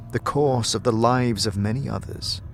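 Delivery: normal talking voice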